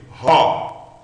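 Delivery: RÓ with an audible H, loud open o; English H. Shown as a demonstration. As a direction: breathe an English H into RÓ. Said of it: "você lê com H do inglês".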